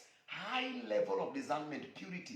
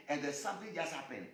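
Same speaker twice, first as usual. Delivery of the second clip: ordinary talk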